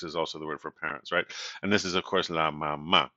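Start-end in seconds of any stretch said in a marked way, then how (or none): 2.3-3.1 "mamá" as ma-MÁ, last syllable stressed; accent on final a. Shown as a demonstration. Teacher gives the word for mom, final-syllable stress on "mamá". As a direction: put the weight on the final á of "mamá". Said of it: "with the accent on the a".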